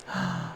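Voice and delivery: sharp gasp. Real, not acted. Reading sad message, shocked.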